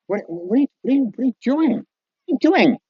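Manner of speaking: high-pitched, stammering mock bewilderment; baffled onlooker. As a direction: sputter the question, voice climbing high.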